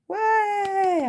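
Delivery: delighted high exclamation; surprised. Real, not acted. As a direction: gasp it out in surprise. Ultra rare surprise.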